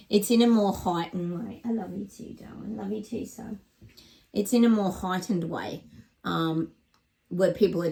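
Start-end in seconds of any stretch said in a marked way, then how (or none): 1.6-3.6 tender murmur. Real, not acted. Tender words to her dog.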